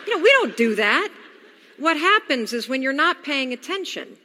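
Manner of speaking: usual speaking voice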